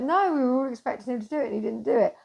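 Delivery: gushing, rapid retold reply; excited rush. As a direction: tumble the words out in excitement.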